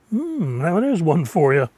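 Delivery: normal talking voice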